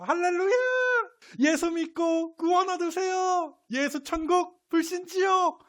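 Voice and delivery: very high, strained chanting shout; hammed-up high-pitched evangelist holler; mock street-evangelist shout. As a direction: cry it out like a street preacher, hammed up. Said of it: "이런 식으로 외치지 않았을까 하는데요".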